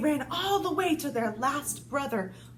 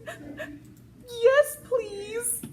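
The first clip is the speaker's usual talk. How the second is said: whimpering wolf; 9 semitones higher than usual. Acted. Crying wolf begs.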